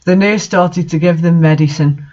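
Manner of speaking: ordinary delivery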